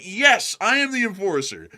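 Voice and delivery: high, loud proud announcement; mock grand reply. Host answering an excited fan, hammed-up proud reply. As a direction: announce yourself like a hero. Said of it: "and it's like".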